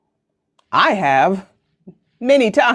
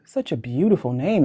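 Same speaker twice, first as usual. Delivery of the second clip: normal talking voice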